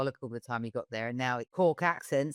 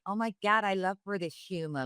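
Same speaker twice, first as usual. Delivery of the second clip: higher-pitched mock American gushing; American accent; 6 semitones higher than usual. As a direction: imitate a gushing American fan. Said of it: "You meet Americans, even now, they're like".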